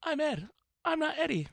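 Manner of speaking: put-on high squeaky kid voice, teasing; mocking high toddler voice. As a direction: mimic a little kid insisting on his name. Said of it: "makes you sound like a toddler".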